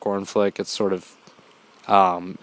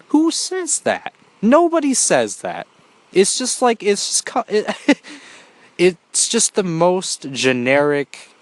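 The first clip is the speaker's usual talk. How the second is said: much higher, slow incredulous scoffing; exasperated disbelief; 7 semitones higher, slower than usual. Real, not acted. Reviewer mocking a cheesy movie line, incredulous and exasperated. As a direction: pitch it way up in mock outrage at the line.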